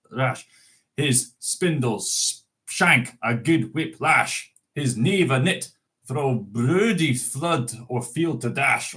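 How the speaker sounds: reciting Burns in a lightly put-on Scots accent; an unpractised Scots accent, recited; Scots poem reading. Shown as a demonstration. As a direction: read it out like a Burns Night address in Scots. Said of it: "I'm not used to speaking Scots".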